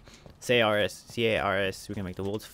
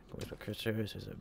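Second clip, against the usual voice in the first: breathy whispered aside; 10 dB quieter than usual. Real, not acted. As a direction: whisper it to yourself.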